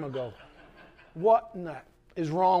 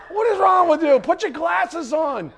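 mock yell; 11 semitones higher, 10 dB louder than usual. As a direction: yell at the sheep through laughter. Shepherd yells at straying sheep.

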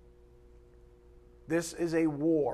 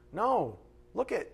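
normal talking voice

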